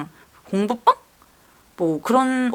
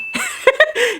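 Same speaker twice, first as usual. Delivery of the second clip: high laugh. Real, not acted. Nervous laugh after story beat.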